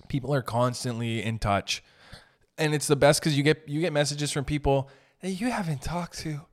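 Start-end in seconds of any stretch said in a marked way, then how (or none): none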